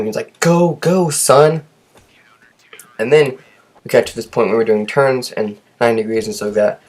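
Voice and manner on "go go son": high, loud urging cry; retold yell. Driving instructor yelling at the student to go, loud. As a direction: push the student on with a shout. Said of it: "he was, like, screaming".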